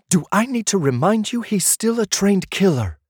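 sister voice. A narrator's reading. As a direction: play the sister snapping back at her brother.

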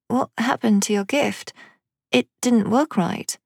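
put-on high child's voice, puzzled; puzzled little girl. A narrator's reading. Amelia squinting at her father's palm, puzzled. Daughter questions father's failed gift.

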